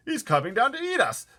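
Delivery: high, loud panicked wail; wailing in fear. Acted. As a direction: wail that he's coming to eat them. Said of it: "wailed the old green grasshopper".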